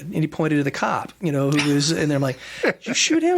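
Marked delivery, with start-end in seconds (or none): 2.8-3.4 retold disbelieving question, pitched way up; stunned disbelief. ER doctor asking the cop in disbelief, high astonished squeak. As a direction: squeak it out in shock, mimicking yourself.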